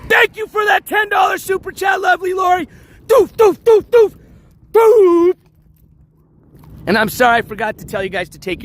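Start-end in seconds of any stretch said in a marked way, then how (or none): 0.1-2.7 donation yell. Real, not acted. Streamer yelling thanks for a super chat. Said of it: "I have to reserve my yelling". 3.1-5.3 high, loud vocal thuds; gavel sounds. Real, not acted. Streamer voicing an imaginary gavel, loud booming thuds. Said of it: "Imaginary gavel".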